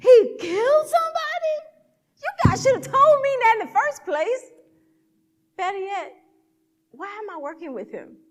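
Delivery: high, loud alarmed protest; shocked outburst. Retold or imitated. Worker learning the patient killed somebody, shocked protest.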